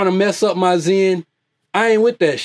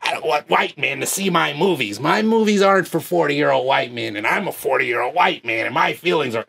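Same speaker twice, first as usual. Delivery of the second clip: a lightly lowered, put-on mopey whine; mocking fan voice; 3 semitones lower, 3 dB quieter than usual. Vlogger mimicking critics, low mopey mock whine. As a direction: parody a fan sulking over movies. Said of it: "That's how y'all sound".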